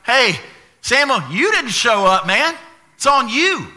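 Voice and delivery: loud, high accusing protest; hammed-up defensive outburst; blame-shifting. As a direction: throw the blame back indignantly. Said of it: "Blaming God. You're like".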